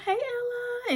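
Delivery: put-on high, soft voice for a child; cutesy greeting. Woman greeting a customer's little girl, cutesy and high. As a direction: coo softly, way up high.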